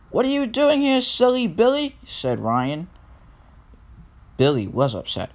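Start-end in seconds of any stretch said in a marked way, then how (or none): 0.1-2.0 high-pitched storybook scolding; high, exclaiming question; startled scolding. A narrator's reading. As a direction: challenge the silly one, pitched high. Ryan challenges Billy after near crash.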